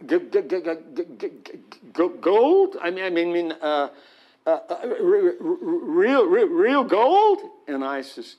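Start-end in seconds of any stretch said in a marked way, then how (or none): 0.1-2.8 stuttering rabbi. Retold or imitated. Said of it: "he starts stuttering". 2.8-7.4 stuttering disbelief. Retold or imitated. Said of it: "he starts stuttering".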